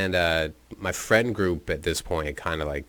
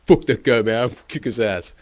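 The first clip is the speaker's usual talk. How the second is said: mock bravado; 4 semitones higher, 6 dB louder than usual. Schmokes blustering after punch.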